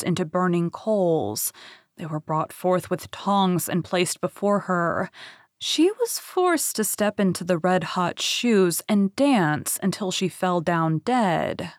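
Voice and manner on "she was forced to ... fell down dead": ordinary delivery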